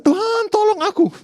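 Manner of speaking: very high, loud cry for help; desperate yell. Acted. Petrus sinking, cries to Tuhan for help, desperate yell. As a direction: yell it out as if going under. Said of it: "dia cuma teriak".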